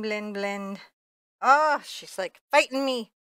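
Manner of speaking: ordinary talk, nothing marked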